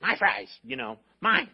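put-on loud possessive kid's whine; selfish kid. Pastor imitating a selfish child grabbing fries, loud. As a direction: claim the fries like a greedy child. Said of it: "are selfish?".

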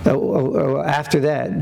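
normal talking voice

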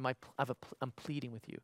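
ordinary talk

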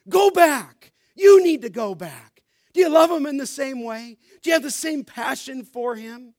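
rising to a loud preacher's shout on each "go back"; preaching at full force. Acted. Pastor urging the congregation to go back, full-force preaching. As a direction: pound each "Go back" harder, building.

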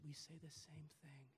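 soft unvoiced whisper. Preacher to congregation, leaning in with a whisper. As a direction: drop to a hushed whisper.

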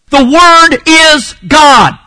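loud, high, slow "The Word is God", word by word; emphatic declaration. Shown as a demonstration. Preacher drives the grammar parallel home, loud and emphatic. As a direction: hammer "Word", "is" and "God" separately.

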